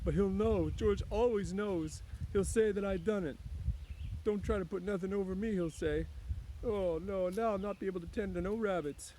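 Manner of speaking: put-on childlike despair breaking out; high, pleading Lenny lament; sorrowfully. A narrator's reading. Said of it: "He went on sorrowfully".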